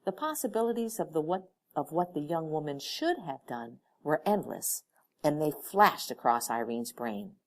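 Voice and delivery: ordinary delivery, nothing marked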